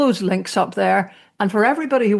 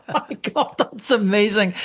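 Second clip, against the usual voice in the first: laughing delight. Retold or imitated. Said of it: "And I was like".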